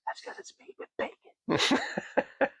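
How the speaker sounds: slow, hushed whisper, leaning close; whispered reply. Retold or imitated. Distiller leaning in to answer the customer, slow whisper. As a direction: let him in on the secret, slowly. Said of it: "I leaned in and says".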